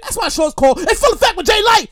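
ordinary talk

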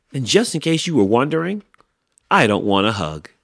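fast, high, loud retort; indignant. A narrator's reading. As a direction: rattle off a huffy rebuff. Grumpy Hank rebuffs the lizard.

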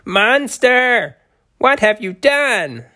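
high tiny terrified shriek; shrieking in terror. Acted. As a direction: shriek it like a tiny scared creature. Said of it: "A tiny voice shrieked in terror".

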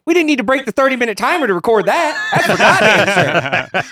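high, loud, laughing through the words; cracking up while firing back; laughing retort. Retold or imitated. To Jose about the camera timer, laughing.

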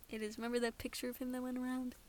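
normal talking voice

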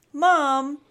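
loud, high call; crying out. A narrator's reading. Snip calling to Mom in frustration, crying out. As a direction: cry out for mom. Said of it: "cried Snip".

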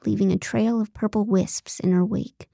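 ordinary delivery

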